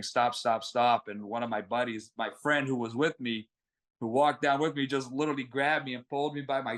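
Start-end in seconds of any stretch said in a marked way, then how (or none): none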